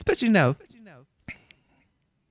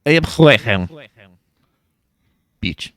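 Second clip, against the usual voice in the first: lightly done Russian boast, louder start; Russian accent; 8 dB louder than usual. Pretend. [name] now a Russian, loud mock Russian accent. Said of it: "you're Russian now".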